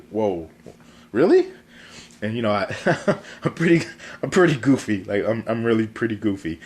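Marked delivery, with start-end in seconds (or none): 0.1-1.5 blown away, can't believe the praise; amazed disbelief. Retold or imitated.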